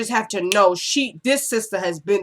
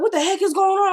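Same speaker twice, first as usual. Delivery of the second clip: very high, frantic imitation; high-pitched mock bewilderment; mock alarm; 12 semitones higher than usual. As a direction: squeal it like a bewildered man in a panic. Acting out the man's alarm.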